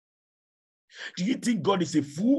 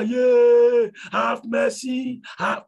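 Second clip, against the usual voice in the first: high, loud desperate wail; damned man crying out from hell, desperate wail; crying out for mercy; 8 semitones higher, 8 dB louder, much slower than usual. Acted. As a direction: beg for mercy as you fall. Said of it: "The man began to cry".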